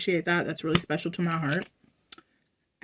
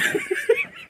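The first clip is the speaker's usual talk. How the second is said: a sudden high squeaky laugh; high giggle. Real, not acted. Vlogger laughing at her own remark, high giggle. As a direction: burst into a giggle at yourself.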